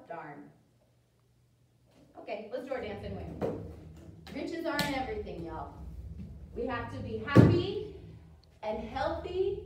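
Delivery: normal talking voice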